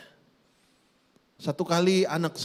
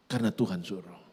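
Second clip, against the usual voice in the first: normal talking voice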